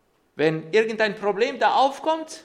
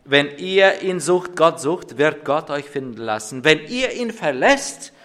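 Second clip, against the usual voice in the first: forceful sermon preaching; 4 semitones lower, 7 dB louder than usual. Acted. Pastor proclaiming the prophet's words to the church, forceful preaching. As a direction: proclaim the verse to the congregation with weight.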